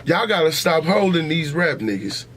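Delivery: ordinary talk, nothing marked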